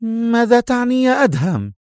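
high-pitched woman's question; high, startled woman's voice; Sonia's character voice. Acted. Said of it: "على حين سألته سونيا".

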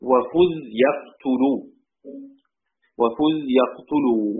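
slow measured chant of وفز يقتلوا; slow verse recitation. Shown as a demonstration. Teacher reciting the verse twice for students, slowly. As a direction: draw out وفز يقتلوا like a poem line. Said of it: "ثم قال رحمه الله".

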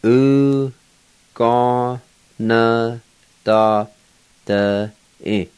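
"U, ga, na, da, da, e" each held separately; slow breakdown with pauses between syllables; syllable-by-syllable pronunciation. Shown as a demonstration. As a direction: sound out every syllable, including a full "na". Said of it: "pronounce these words".

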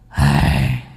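low, drawn-out villainous sigh; lightly voiced scheming shadow, low; sinister shadow. A narrator's reading. Shadow sighs at the protagonist. (tiếng thở kéo dài của cái bóng ác tâm)

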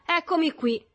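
high, loud cocky announcement; brash, showy entrance; swaggering. A narrator's reading. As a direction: announce yourself with bravado. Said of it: "si presenta con spavalderia".

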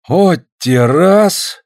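high, very slow astonished exclamation; repeated surprise. A narrator's reading. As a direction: drag it out in bewilderment. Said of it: "опять сказал Афанасий".

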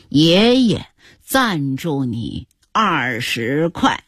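slow, low, deliberate offer; generous grandpa. Acted. Grandpa hands granddaughter money, slow grand offer. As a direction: announce it grandly, word by word.

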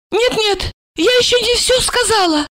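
high-pitched urgent refusal; tipsy woman clinging on; protesting drunk woman. A narrator's reading. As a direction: object in a high, insistent voice.

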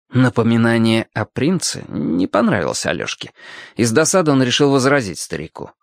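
usual speaking voice

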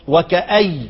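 وكأي said "wa-ka-ayy"; stop on the ya. Shown as a demonstration. Teacher repeating Abu Amr's pause, وكأي ending "ayy". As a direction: close وكأي on y, with no n. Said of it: "هيقف على إيه؟".

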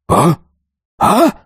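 high, short puzzled "huh?"; startled voices. A narrator's reading. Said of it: "череда удивлённых голосов". (удивлённое «а?»)

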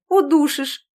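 high squealing cry. A narrator's reading. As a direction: squeal as the hug tightens. Squeezed in a hug.

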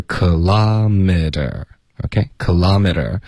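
kilometer stressed on the second syllable, "kuh-LAH-meter"; slow American kilometer, punch the LAH; American pronunciation. Shown as a demonstration. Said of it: "一般美语的发音是".

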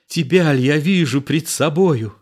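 high, incredulous cry; amazed. A narrator's reading. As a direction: exclaim in joyful disbelief.

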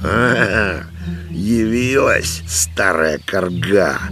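high, drawn-out jeering cry; old man's yell. A narrator's reading. Old man spots his wife arriving, scornful yell. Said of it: "завопил он".

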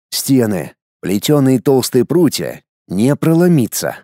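usual speaking voice

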